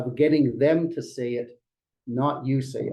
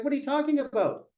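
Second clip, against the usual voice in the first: high, indignant mock complaint, pitched up; mock angry client; 7 semitones higher than usual. Client scolding agent, high, mock indignant. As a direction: voice the upset client pushing back at the agent. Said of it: "they're mad".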